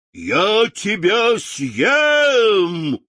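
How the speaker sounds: slow, loud, drawn-out roar; roaring lion. A narrator's reading. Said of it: "проревел". (рычащий лев)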